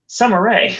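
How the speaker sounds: high, bright announcement of the name; excited name call. Acted. Co-host teasing the viewer, calling out a name high. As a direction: sing out the name like a reveal.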